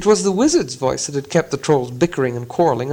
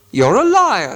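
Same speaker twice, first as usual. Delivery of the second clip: higher, louder troll retort; troll insult; 7 semitones higher, 5 dB louder, slower than usual. Acted. William calling Bert a liar, loud troll retort. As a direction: fling the insult back. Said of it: "said William".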